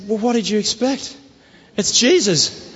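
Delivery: high, beaming and triumphant; of course it worked, grin through it; delighted. Retold or imitated. Telling the healed girl it's Jesus, high and delighted.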